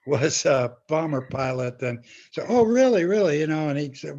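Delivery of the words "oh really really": mock surprise. Retold woman surprised by menu.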